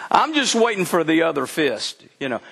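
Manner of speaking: ordinary talk, nothing marked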